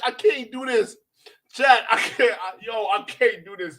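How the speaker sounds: normal talking voice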